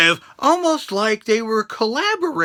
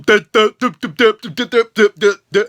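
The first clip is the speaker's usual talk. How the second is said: mock stutter; faster than usual. Retelling Big Cass mocking a stutterer, choppy mock stutter. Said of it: "he's going".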